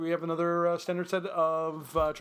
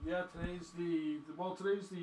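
off mic; 8 dB quieter than usual. Real, not acted. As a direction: voice fading away from microphone. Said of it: "You're off mic".

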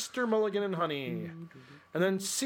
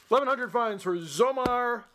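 normal talking voice

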